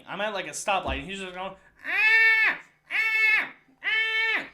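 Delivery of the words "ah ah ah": high, loud car-alarm scream; repeated blaring shrieks imitating a ranting man; imitated shouting. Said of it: "he's just shouting".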